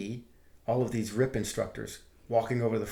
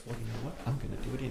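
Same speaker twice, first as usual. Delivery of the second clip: quiet; 8 dB quieter, much faster than usual. Retold or imitated. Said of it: "the optimistic me says".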